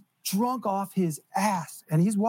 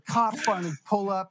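normal talking voice

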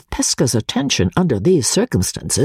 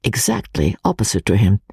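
ordinary delivery, nothing marked

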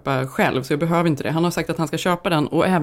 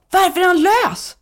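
high, loud mock outrage, slow; hammed-up indignant question; imitating husband's indignant outcry; 13 semitones higher, 5 dB louder, slower than usual. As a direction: ask it like a baffled, annoyed husband. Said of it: "Han bara".

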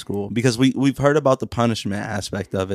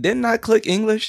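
confused caller; 12 semitones higher than usual. Pretend. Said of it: "It'd be like".

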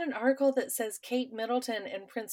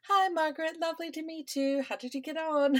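posh British; 9 semitones higher than usual. Retold or imitated. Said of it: "they were like".

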